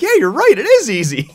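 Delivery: very high and loud, breaking into laughter; laughing agreement. Retold or imitated. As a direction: cheerfully agree while cracking up. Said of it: "And he's like".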